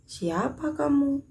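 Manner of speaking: slow, high-pitched example question; questioning tune. Shown as a demonstration. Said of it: "kalimat yang diakhiri tanda tanya".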